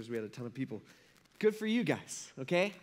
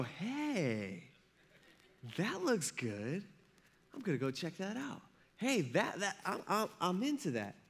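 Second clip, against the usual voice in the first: softer, slowed, laughing voice of a tempted fish; 5 dB quieter than usual. Acted. Preacher voicing a fish lured by bait, soft and laughing. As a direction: play the fish falling for the bait.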